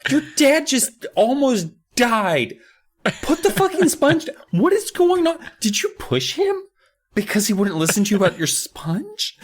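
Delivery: high, incredulous scolding; exasperated mom. Retold or imitated. Mom yells at the kid over the sponge, high and incredulous. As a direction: scold like a mother at her wits' end. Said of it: "It's just like".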